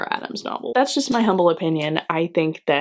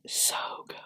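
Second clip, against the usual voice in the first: whisper; 14 dB quieter than usual. Acted. Gushing about the book.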